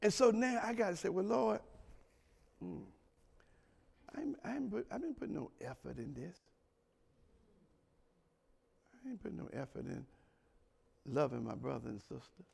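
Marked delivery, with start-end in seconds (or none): 1.1-12.4 very soft, low, hesitant murmur to God; acted sheepish admission, barely voiced; hushed confession. Believer admitting to the Lord no effort, hushed confession.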